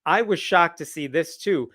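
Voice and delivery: normal talking voice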